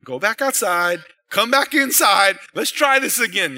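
hammed-up wife voicing a redo; mock do-over order. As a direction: playfully send him back out and in. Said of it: "kinda like".

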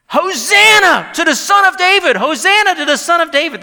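very high, loud acclamation; screaming shout. Acted. Preacher voicing children acclaiming Jesus in the temple, screaming shout. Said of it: "they're shouting".

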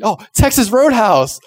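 high, loud burst of mock enthusiasm; excited. Kid answering parents without fear of rejection, excited. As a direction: blurt it out as the obvious favorite. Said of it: "say my answer excitingly".

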